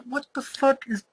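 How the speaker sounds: high, slowing incredulous outburst; can't believe what the characters are doing; high pitched what the fuck; exasperated disbelief. Retold or imitated. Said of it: "and I'm like".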